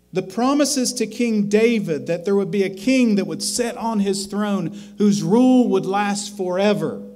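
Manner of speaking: a fervent pulpit crescendo; preaching at full force. Acted. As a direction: swell the list of God's promises toward a peak. Preaching builds to climax.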